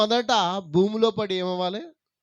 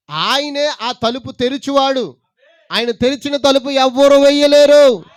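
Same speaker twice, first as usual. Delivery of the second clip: climactic preaching; 7 semitones higher, 12 dB louder than usual. Acted. Preacher assuring the church, rising to a loud climax. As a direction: hammer the promise home.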